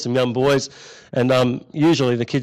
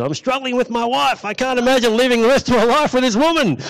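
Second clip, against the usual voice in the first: high mock-whiny moan; complaining husband; 9 semitones higher, 3 dB louder than usual. Middle-aged man moaning about his wife, mock whine.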